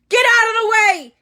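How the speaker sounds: loud high shout; urgent shout. A narrator's reading. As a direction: yell them out of the way. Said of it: "he shouted at Ron and Hermione".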